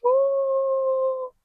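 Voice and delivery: long, high, soft "ooh"; high soft coo. Real, not acted. Cooing over baby clothes, high soft squeal. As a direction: coo softly on one high, held note.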